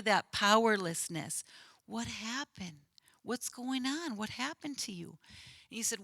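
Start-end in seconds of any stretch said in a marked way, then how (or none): 1.9-5.1 put-on much softer, gentle questions to a hurt child; softly reassuring. Counselor coaxing the boy to talk, soft and gentle. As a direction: ask quietly, as if to a small upset boy. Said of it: "just calmly talking to him".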